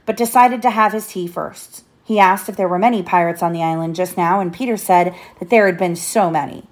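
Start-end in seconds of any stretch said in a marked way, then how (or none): none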